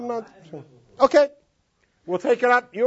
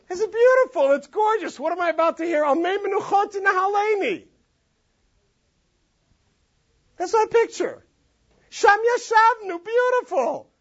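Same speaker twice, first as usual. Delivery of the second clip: much higher, airy take on a pretty picture; naive dreamy reader; 11 semitones higher than usual. Pretend. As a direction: play someone who thinks it's a lovely riverside scene. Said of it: "what's your imagery right now".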